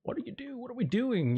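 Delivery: high-pitched imitation of nervous panic; mock scared. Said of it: "but I was also scared".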